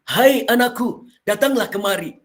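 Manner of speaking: storyteller voicing the father, calling his son; father's voice. Shown as a demonstration. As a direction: call out like Ibrahim summoning his son. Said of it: "ada perubahan suara di sana".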